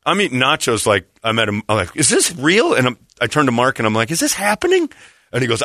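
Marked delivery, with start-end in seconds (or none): 1.9-2.7 high incredulous question; disbelief. Retold or imitated. Bystander eating nachos watches the quarrel, disbelieving. Stunned bystander in retold scene. 4.1-4.9 very high, incredulous question; disbelief. Retold or imitated. Turns to Mark during the quarrel, disbelieving. Stunned bystander turns to friend.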